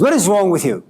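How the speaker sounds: mock angry son, pitch climbing toward the end. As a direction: snap at mom, fed up and rising. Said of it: "really angry".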